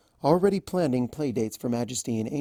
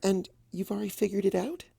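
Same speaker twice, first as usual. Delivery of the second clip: hesitant; 7 semitones higher, 4 dB quieter, faster than usual. A narrator's reading. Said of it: "she asked hesitantly".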